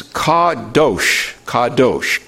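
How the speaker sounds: kadosh said slowly as kah-DOHSH, twice; teacher sounding out kadosh as kah-DOHSH; Hebrew pronunciation. Shown as a demonstration. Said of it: "is how you would pronounce it".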